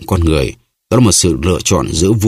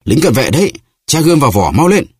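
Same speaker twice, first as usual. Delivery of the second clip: higher-pitched urgent cry of alarm; raised, alarmed exclamation; 8 semitones higher than usual. A narrator's reading. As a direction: call out an urgent warning to companions. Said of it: "cùng kêu lên".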